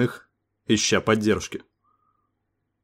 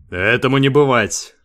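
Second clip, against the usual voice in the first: sharp refusal; 3 semitones higher, 5 dB louder than usual. A narrator's reading. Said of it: "резко сказал Дэйв".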